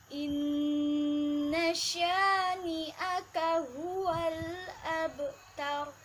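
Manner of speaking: slow melodic tajwid chant; slow chanted Quranic recitation. Shown as a demonstration. Teacher recites verse three.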